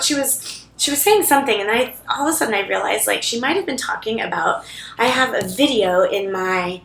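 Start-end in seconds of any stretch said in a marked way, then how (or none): none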